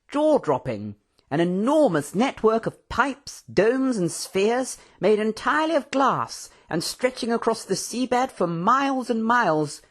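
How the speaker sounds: high, swooping, theatrical raconteur; animated excitement. A narrator's reading. Professor recounting his adventures to Mister Tando, excited. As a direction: relish every adjective, pitch bouncing high. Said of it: "with the usual animated gestures and excitement".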